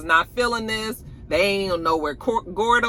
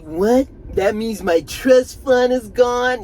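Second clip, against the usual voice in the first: higher, slow stunned question; shocked Tariq; 5 semitones higher, 5 dB louder, slower than usual. Retold or imitated. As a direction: stretch out the disbelief. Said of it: "Tariq was like".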